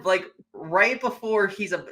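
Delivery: ordinary delivery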